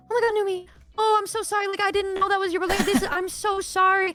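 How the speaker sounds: high-pitched, rushed gushing apology; profuse apology. Retold or imitated. Girl apologizing to the teller over the phone, profusely apologetic. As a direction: imitate a girl apologizing in a fast, high gush. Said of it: "this girl was profusely apologizing to me".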